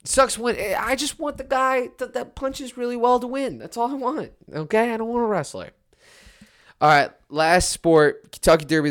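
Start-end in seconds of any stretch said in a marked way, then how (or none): 0.0-5.7 high-pitched rant. Real, not acted. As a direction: voice way up, insistent. Ranting complaint about wrestling.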